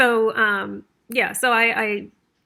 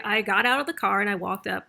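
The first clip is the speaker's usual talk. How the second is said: ordinary talk, nothing marked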